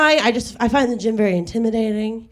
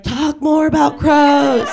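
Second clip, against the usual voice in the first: eager, higher and slow; 7 semitones higher, 4 dB louder, slower than usual. Retold or imitated. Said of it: "I was back there like".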